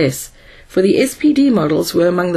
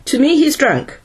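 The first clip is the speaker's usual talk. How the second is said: higher-pitched, emphatic insistence; insistent counter-claim; 7 semitones higher than usual. A narrator's reading. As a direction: state it firmly, pitched up. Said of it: "stated Donovan emphatically".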